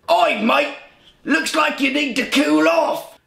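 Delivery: high, loud mock British holler; stupid British accent. Injured bandmate mimics Dickie by the pool, mocking British accent. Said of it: "in that stupid goddamn British accent".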